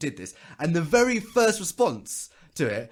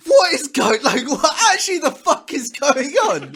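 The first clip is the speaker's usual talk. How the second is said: high laughing outburst, slowed and incredulous; exasperated shout; 10 semitones higher, 9 dB louder than usual. Retold or imitated. Venting at grief-shaming posts, a laughing exasperated shout. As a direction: yell in disbelief while cracking up. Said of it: "it's like".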